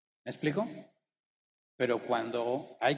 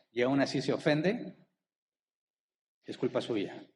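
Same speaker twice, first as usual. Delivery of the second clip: ordinary talk, nothing marked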